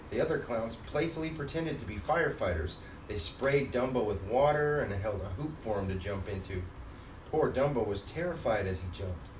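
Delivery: usual speaking voice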